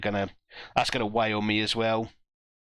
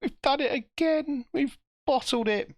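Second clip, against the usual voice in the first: high despairing squeal. Real, not acted. Despairs at own tactical blunder.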